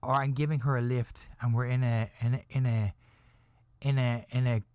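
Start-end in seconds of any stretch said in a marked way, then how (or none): none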